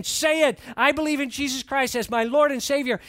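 loud, high, insistent order; forceful demand. Retold or imitated. Boy on top of the bully demanding the confession, loud and forceful.

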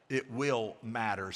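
"will" drawn out slowly; slow, deliberate "will"; stress on "will". Shown as a demonstration. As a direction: lean on "will", taking it slow. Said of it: "Put will emphasis".

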